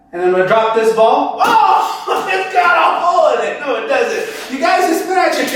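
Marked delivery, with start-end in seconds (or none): 1.4-3.6 high-pitched feigned alarm; high, put-on "oh no" reaction; mock surprise. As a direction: act caught out by a failed trick. Feigned surprise at failed trick.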